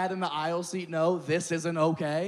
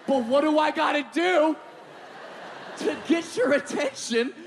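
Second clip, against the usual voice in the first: exasperated plea; 10 semitones higher than usual. Pretend. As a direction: beg for the dad's attention.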